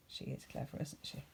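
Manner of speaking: soft whispery aside; hushed aside; quiet aside. Acted. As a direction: confide it to the child. Aside to child listener.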